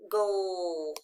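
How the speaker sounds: "goal" drawn out, falling; long "goal" sinking in pitch; isolated final syllable. Shown as a demonstration. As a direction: stretch "goal" as one lone syllable. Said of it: "the final stable syllable".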